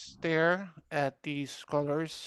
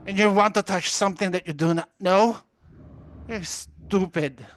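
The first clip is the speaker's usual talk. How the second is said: loud, furious yelling at Falion; angry, frustrated voice; 3 semitones higher, 6 dB louder than usual. Acted. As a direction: snap at him like he's hopeless. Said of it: "this one is angry, frustrated".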